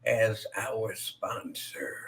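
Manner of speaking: whispered aside. Acted. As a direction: drop to a breathy whisper.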